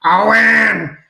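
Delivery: a hint of Mama Fratelli's bark, loud. Doing his Mama Fratelli impression for his mate, loud call. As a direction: bellow the name like a gruff gangster mum. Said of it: "do my Mama Fratelli".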